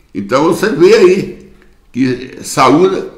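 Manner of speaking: normal talking voice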